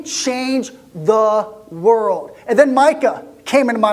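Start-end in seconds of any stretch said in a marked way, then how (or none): 0.0-2.4 change the world drawn out very slowly; slow, awed emphasis. Acted. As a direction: stretch each word, full of wonder. Repeats the line for emphasis.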